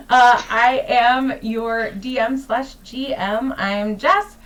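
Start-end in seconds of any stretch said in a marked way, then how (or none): none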